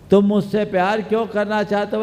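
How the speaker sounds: high, loud and quick challenge; insistent questioning. Retold or imitated. Sensible girl challenges her suitor, insistent. Girl's question retold more forcefully.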